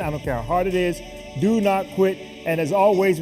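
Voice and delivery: ordinary talk, nothing marked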